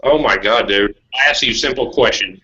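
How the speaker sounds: normal talking voice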